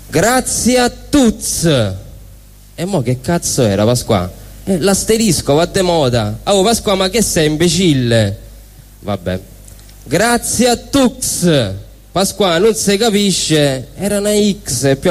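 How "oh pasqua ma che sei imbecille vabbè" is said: ordinary talk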